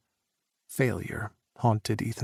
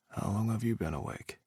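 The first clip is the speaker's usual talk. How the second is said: soft morning murmur; 8 dB quieter than usual. A narrator's reading. Sleepy morning in bed.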